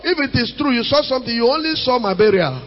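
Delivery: normal talking voice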